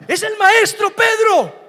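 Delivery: slow, loud, high cry of recognition; excited shout. Acted. John calling to Peter from the boat, excited shout. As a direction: shout it out as you spot him.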